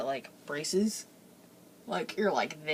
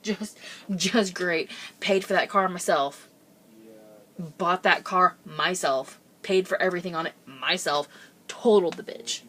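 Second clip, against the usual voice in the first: loud, emphatic mock rant; bitter sarcasm, punching out each phrase; retold exasperation; 7 dB louder than usual. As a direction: replay being mad at yourself, louder and deliberate. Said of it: "I was so mad".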